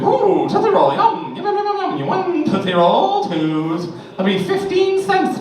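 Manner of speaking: very high, squeaky hammed-up shopkeeper; goofy candy man. Imagined candy store owner to customer, squeaky high goofy voice.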